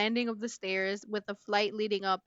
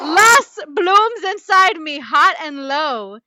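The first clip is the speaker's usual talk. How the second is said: much higher, much louder and slow, overplayed lust; mock-passionate reading; 6 semitones higher, 15 dB louder, slower than usual. Teasing her friend with the lust passage, slow hammed-up swooning. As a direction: stretch every word like a parody of desire.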